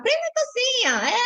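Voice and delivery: high, slow, stretched repeat; squeaky-high coaxing repeat; playful insistence. Acted. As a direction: lift the pitch high and draw it out. Mock objection to pinning on panties.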